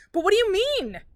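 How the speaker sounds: high, loud scream; shrill desperate scream. A narrator's reading. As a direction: scream it at her mother. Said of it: "she'd screamed at her mother".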